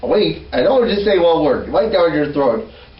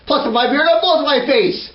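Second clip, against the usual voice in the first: ordinary talk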